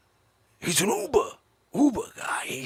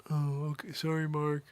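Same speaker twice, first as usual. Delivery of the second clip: imitating Zabrowski leaving; 3 semitones lower, 8 dB quieter than usual. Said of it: "Did you hear that on the way out?".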